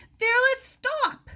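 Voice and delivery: very high, loud yell; sharp, very high shout after a runaway dog; frantic yell. Acted. Chasing dog on beach.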